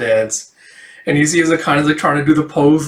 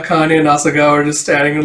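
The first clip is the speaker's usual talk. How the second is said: ordinary delivery, nothing marked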